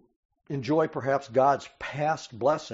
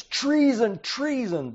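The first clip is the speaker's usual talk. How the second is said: higher, louder cry of treason, fading; acted scream; 9 semitones higher, 5 dB louder, slower than usual. Preacher acting the queen screaming at the crowd, outraged. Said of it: "so she screams".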